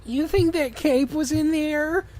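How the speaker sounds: high, slow, lightly put-on friend's voice; a light, wide-eyed sidekick asking; lightly voiced curious friend; Chris voice. Voicing Chris in the fanfic.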